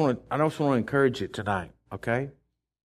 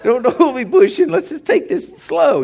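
anxious plea; 13 semitones higher, 6 dB louder than usual. Retold or imitated. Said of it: "I'm like".

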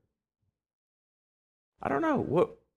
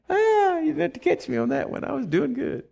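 high crying laugh at being caught; playful cry to God; 11 semitones higher than usual. Real, not acted. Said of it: "How about that one, Lord?".

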